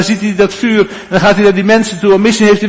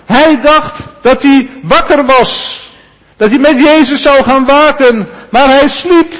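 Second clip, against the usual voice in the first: normal talking voice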